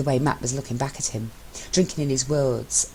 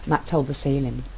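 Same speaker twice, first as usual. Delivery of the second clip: usual speaking voice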